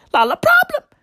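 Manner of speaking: a high mocking squeal. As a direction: push the mock way up into a squeal. Mocking repeat climbs further.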